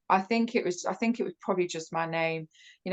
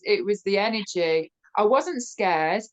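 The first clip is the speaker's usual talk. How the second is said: ordinary delivery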